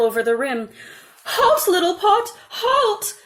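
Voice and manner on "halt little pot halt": high, loud frantic plea; panicked cry. A narrator's reading. Mother trying wrong words on the overflowing pot, panicked and high. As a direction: desperately try to stop the pot. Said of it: "the mother cried".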